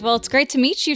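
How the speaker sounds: ordinary delivery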